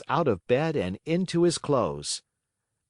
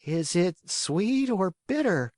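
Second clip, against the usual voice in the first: high, slow whine; whining puppet boy; 9 semitones higher than usual. Acted. Pinocchio whining to the fairy about medicine. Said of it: "asked in a whining voice".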